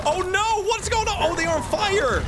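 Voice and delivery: high loud panic; panicked. Real, not acted. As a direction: freak out at the chaos. Panic in game attack.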